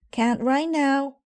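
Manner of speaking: put-on high, loud child shout; shouted from upstairs. A narrator's reading. Sister shouting down to Mama from upstairs. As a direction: yell it down the stairs. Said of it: "shouted Sister from upstairs".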